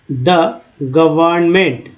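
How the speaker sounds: "Government" broken into gə-vərn-ment, slowly; slow syllable-by-syllable. Shown as a demonstration. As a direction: sound out "government" part by part. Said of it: "इसको धीरे धीरे पढ़ते हैं". ("Government" को धीरे, तोड़कर पढ़ें)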